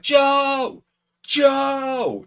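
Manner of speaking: high, loud shouts across the arena; calling out. Retold or imitated. Fans at ringside shouting to get Joe's attention, loud high calls. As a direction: yell his name to get his attention.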